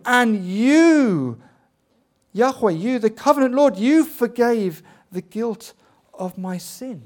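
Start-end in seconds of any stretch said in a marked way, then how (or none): 0.1-5.7 you hit loud and high each time; stress on you. Shown as a demonstration. Preacher to congregation, stress on you, loud and high. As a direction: lean hard on every you, loud and high. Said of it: "The emphasis is there on you".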